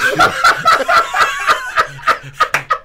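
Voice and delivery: shrieking burst of laughter; helpless high laugh; high cackle. Real, not acted. Big laugh at the joke.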